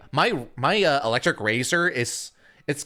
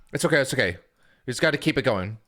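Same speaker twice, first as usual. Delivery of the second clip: usual speaking voice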